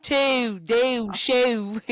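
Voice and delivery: loud Baltimore accent. Shown as a demonstration. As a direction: Baltimore accent, each word punched. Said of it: "like the Baltimore people".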